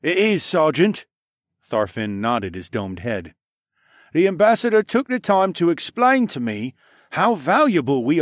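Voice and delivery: captain's voice. Acted. Captain Tharfin assuring his sergeant, steady commanding voice. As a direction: speak with calm authority.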